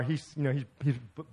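ordinary talk

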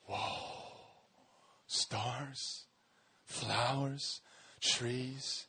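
breathless awed whisper, barely voiced. Eve opening her eyes to the stars, an awed whisper. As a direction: hush it out in wonder, word by word.

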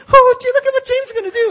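very high, loud, fast squeal; imitated girls. Girls watch the boy's stunt, high squealing imitation. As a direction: squeal it as a gaggle of admiring girls. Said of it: "And the girls say".

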